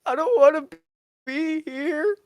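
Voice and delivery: mock crying Biden. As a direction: fake a choked-up old man. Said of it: "starts crying and being like".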